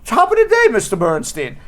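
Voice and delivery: mock British greeting, starting high; bad British accent. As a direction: attempt a British accent and fail cheerfully. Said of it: "I can't do a British accent".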